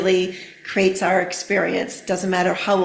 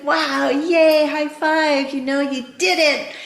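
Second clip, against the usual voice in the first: cheering praise; 6 semitones higher than usual. Retold or imitated. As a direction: celebrate the kid's win with a high five. Cheering child's success.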